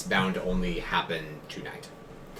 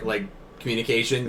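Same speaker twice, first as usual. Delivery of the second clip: usual speaking voice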